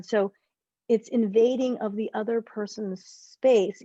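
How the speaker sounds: normal talking voice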